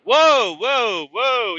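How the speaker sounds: loud, high alarmed whoa. Retold or imitated. To the card grader, a loud high alarmed whoa. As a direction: rear back and shout a stop. Telling collector to back off.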